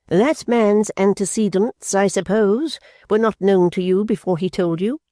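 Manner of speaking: cold cross-examination; stern mother. A narrator's reading. Mother questions son.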